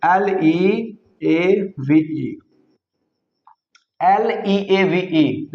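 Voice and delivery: very slow letter-by-letter spelling. Shown as a demonstration. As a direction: drag out each letter for dictation. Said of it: "लिव". (बहुत धीरे, एक-एक अक्षर बोलकर)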